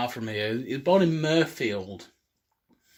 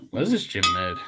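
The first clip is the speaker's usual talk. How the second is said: usual speaking voice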